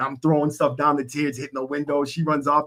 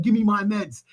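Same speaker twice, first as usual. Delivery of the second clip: mock demand; 8 semitones higher than usual. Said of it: "He's like".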